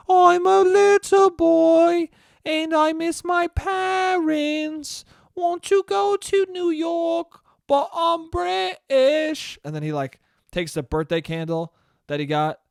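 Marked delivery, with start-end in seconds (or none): 0.1-9.6 put-on very high, very slow whimpering boy; sad little British boy. James alone by his lamp, slow high childlike voice. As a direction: drag it out like a pitiful small boy.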